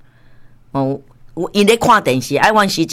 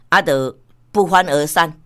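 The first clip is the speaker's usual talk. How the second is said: ordinary talk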